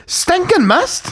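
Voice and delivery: high, slow baffled mum; bewildered mum. Retold or imitated. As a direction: drag it out in total confusion. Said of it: "And she goes".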